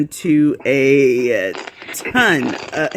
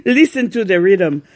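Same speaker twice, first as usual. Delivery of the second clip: louder, hammed-up Jamaican accent; bad Jamaican accent; 3 semitones higher, 5 dB louder than usual. Shown as a demonstration. Host clowns with her friend's voice, mock Jamaican accent. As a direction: punch it out in a badly faked Jamaican lilt. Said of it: "it's kind of Jamaican".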